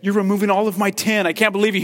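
a toy sputtering in outrage; fast, high, loud toy complaint; indignant toy. Retold or imitated. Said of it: "this toy would probably look at you and say".

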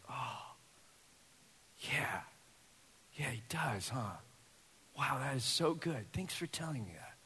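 put-on tender, touched answer to a child; hushed, moved. Pastor retelling his hushed, moved reply to his daughter.

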